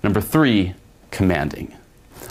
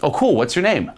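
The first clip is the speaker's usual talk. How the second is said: playful fun; 8 semitones higher than usual. Shown as a demonstration. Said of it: "in a way where it's adding to the fun".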